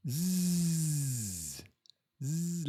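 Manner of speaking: long drawn-out "zzz"; sustained buzzing noise; imitated buzz. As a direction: buzz through the teeth.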